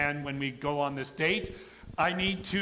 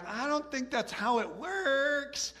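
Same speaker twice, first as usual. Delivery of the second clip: higher, slow, mock-doubtful young woman; a hint of a puzzled girl objecting; Mary doubting the angel, higher and slow; lightly voiced doubtful Mary; 9 semitones higher, slower than usual. And she's like.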